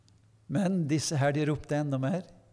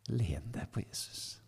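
whisper; 3 semitones lower, 10 dB quieter than usual. Retold or imitated. Pastor repeating comfort to a tired youth, whispered. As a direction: repeat it in a soft whisper, much softer. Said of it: "Så sa jeg det bare på den måten".

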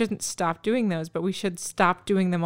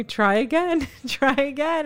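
put-on child repeating; 8 semitones higher, slower than usual. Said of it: "he started repeating you".

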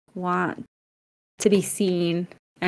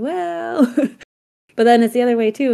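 skeptical doctor; 5 semitones higher, 6 dB louder than usual. Retold or imitated. Doctor answering a patient who says it's fun, high doubtful hedge. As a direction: imitate a doctor politely disagreeing, pitched up.

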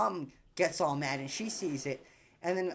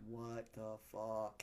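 stunned dad; 8 semitones lower, 12 dB quieter, much slower than usual. Retold or imitated.

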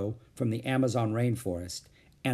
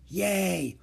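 ordinary delivery, nothing marked

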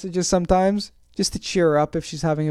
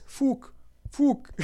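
high, softer mock Irish pronunciation; Irish accent; 8 semitones higher, 5 dB quieter than usual. Showing how the fighter says it, mock Irish accent. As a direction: try the word in a fake Irish accent. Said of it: "That's my Irish accent".

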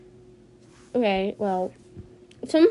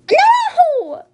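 sudden shriek, much higher and louder; 8 dB louder than usual. Real, not acted. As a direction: yelp at the bad play. Card game goes wrong.

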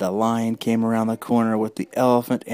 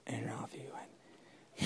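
whisper; 2 semitones lower, 15 dB quieter, slower than usual. A narrator's reading. Whispered narration.

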